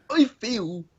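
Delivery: high, slow mock-tearful confession; badly faked crying. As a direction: fake being choked up, unconvincingly. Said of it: "Don't sound like you're crying".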